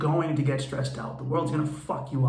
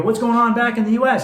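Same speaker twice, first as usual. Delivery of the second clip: much louder and higher, bursting with excitement; loud eager ask; 9 semitones higher, 9 dB louder than usual. Retold or imitated. Back from ayahuasca, eagerly asking about home, loud and excited. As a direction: call it out, fresh from the jungle. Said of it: "and I go".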